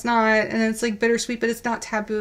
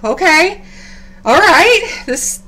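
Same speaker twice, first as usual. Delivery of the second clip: rising, louder, keyed-up retelling; excited disbelief; 8 semitones higher, 6 dB louder than usual. Retold or imitated. She recalls her stunned reaction, excitedly. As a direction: sell the dawning shock of good news. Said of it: "I kinda like".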